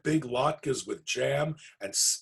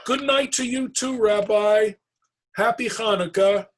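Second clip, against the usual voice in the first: calling out; 11 semitones higher, 7 dB louder than usual. Acted. Calling after the departing bear.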